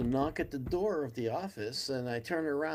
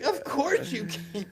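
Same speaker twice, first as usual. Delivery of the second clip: starstruck; 10 semitones higher, slower than usual. Retold or imitated. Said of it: "I'm like".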